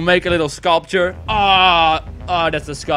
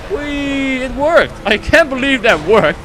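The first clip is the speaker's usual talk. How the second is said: excited; 7 semitones higher, 4 dB louder, slower than usual. Real, not acted. Jump succeeds.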